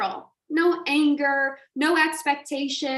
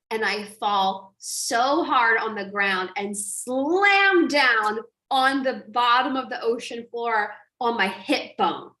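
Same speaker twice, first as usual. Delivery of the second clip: normal talking voice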